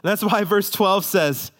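high, driving sermon voice; full-force preaching. Acted. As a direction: preach it with rising fervour.

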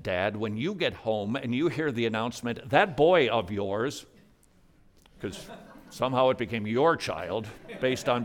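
ordinary talk, nothing marked

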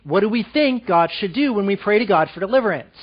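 usual speaking voice

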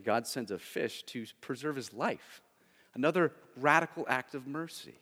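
ordinary talk

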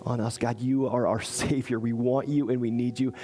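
hushed prayer to close the service; closing prayer. Real, not acted. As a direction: pray gently over the congregation. Pastor leads closing prayer.

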